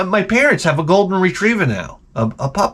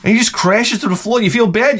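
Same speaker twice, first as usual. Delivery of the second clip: ordinary talk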